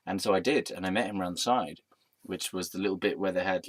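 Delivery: normal talking voice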